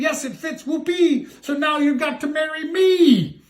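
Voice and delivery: high, triumphant squeal; gleeful scream. Acted. Ugly sister squeals at the prince in triumph. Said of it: "she screamed".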